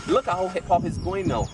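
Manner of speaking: ordinary talk, nothing marked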